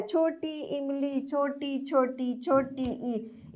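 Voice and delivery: short "ई" said softly, little force. Shown as a demonstration. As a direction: say "छोटी ई" quietly and short. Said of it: "जब छोटी ई बोलेंगे तो ई". ("ई" धीमे, कम जोर से)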